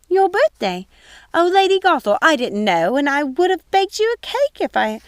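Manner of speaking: high, fluttering dismayed housekeeper; flustered old woman. Acted. Mrs Tittlebottom fusses to Gothel over her birthday. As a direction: fluster and fuss at the news.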